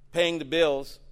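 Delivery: normal talking voice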